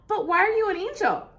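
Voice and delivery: usual speaking voice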